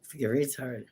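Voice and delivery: whispered aside. Real, not acted. Muttered aside.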